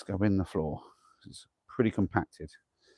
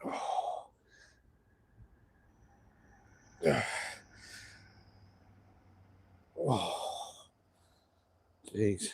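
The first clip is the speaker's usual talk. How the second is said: out of breath; 2 semitones higher, 7 dB quieter, much slower than usual. Real, not acted. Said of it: "Sorry for the huffing and puffing".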